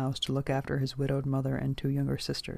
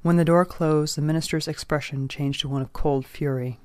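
ordinary delivery, nothing marked

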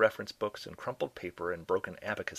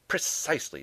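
higher-pitched, emphatic comeback; shot back; 8 semitones higher than usual. A narrator's reading. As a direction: fire back in firm agreement. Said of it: "Doe shot back".

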